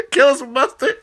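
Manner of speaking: can't stop laughing at the joke; cracking up. Real, not acted. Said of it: "I cracked myself up".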